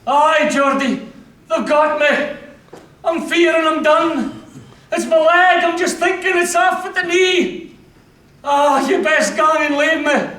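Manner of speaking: high, strained cry of a hurt private; wounded Scots soldier. Acted. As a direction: call out bravely through the pain.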